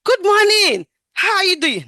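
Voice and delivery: high, loud shouted good morning; loud high greeting. Shown as a demonstration. Nurse showing the wrong way, high and loud. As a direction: belt the greeting too high. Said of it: "I can't say".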